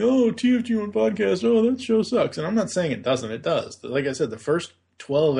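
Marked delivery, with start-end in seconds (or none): 0.0-2.4 high, loud sneering dismissal; mocking critics. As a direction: scoff like a snooty critic. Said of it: "everybody saying".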